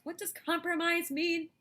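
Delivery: hammed-up question to the class; dramatic question. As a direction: pose it like a big mystery. Teacher asks dramatic question.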